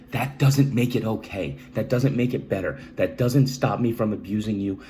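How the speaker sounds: loud, forceful insistence; emphatic. Real, not acted. Speaker confronting viewers about excusing his abuse, forceful and loud. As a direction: hammer the point home hard.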